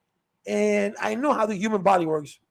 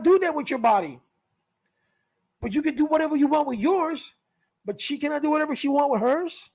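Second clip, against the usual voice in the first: mock preachy scold; 6 semitones higher than usual. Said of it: "comes out and says".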